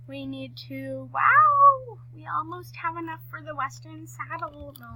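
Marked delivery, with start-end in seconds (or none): 1.1-2.0 surprised. Real, not acted. Surprised at her saved-up coins.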